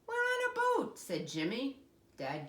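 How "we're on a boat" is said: put-on high, loud protesting boy; child voice. A narrator's reading. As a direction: insist it's a boat. Jimmy protests.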